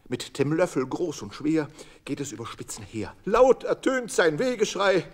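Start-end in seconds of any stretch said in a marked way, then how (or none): none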